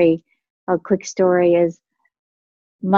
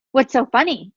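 higher-pitched mock indignant question; mad; 6 semitones higher than usual. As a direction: imitate an annoyed daughter demanding an answer. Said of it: "She was mad".

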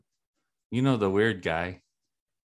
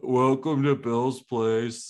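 flat slow welcome; 3 semitones higher, slower than usual. Retold or imitated. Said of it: "And then he's like".

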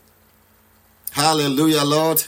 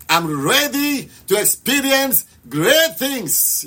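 high, slow, stretched declaration; passionate prayer; 8 semitones higher, slower than usual. Shown as a demonstration. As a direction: lead the congregation with fervour. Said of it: "Pray with passion".

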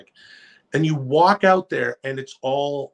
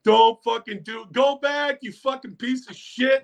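retold crowd jeers, much higher and yelled; imitating hostile crowd; 11 semitones higher than usual. Retelling the crowd cursing him on the ramp, imitated angry yelling. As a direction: yell it like the whole arena booing you. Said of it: "people are like".

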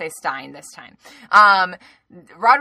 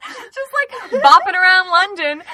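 laughing through the words, higher and louder; 10 semitones higher, 9 dB louder than usual. Real, not acted. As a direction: giggle the whole line out.

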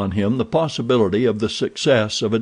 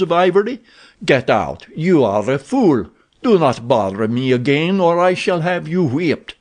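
scornful captor; 5 semitones higher than usual. Acted. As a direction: jeer at the ragged fool, then threaten. Abdul mocks and threatens Werper.

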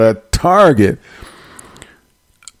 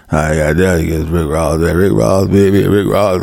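low, mumbling slurred rapper voice; 7 semitones lower than usual. Retold or imitated. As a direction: drop low and mumble the name. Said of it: "send me a translator".

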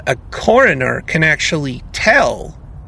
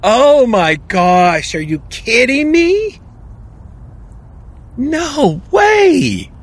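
real incredulous outcry; astonished; 8 semitones higher, slower than usual. Real, not acted. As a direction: gasp it out in delighted shock. Shocked reaction to drink line.

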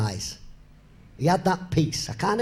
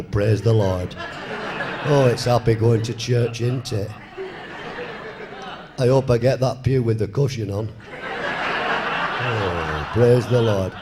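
lower, hammed-up pious churchgoer, laughing; mock churchgoer; 5 semitones lower than usual. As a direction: send up a smug pew-sitter with a chuckle.

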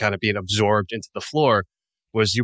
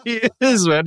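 ordinary talk

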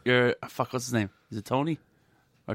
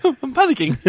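laughing mock panic; 6 semitones higher, 3 dB louder than usual. Waiter retelling free drink, laughing. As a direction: panic line laughed through. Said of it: "It's like".

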